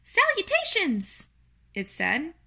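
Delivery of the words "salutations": high little spider voice; tiny creature piping up; small voice. A narrator's reading. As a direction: greet in a tiny, high-pitched squeak. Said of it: "he heard a small voice".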